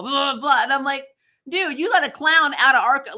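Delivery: normal talking voice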